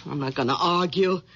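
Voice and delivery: low, nagging housewife voice; low, bossy nagging wife. Acted. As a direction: scold him like a domineering wife.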